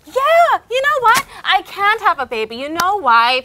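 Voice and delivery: high, loud, wild mock confession; high excited deranged reply; mock crazy answer. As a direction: act unhinged to scare off questions. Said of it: "I'll be like".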